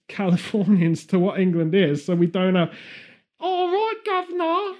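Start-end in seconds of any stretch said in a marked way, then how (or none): 3.4-4.8 high-pitched mock Cockney "all right, governor", pitched well up; stock British accent. Mocking how Californians picture English talk, in an exaggerated British accent. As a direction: ham up the cliché British accent Americans expect. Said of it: "as our standard British accent".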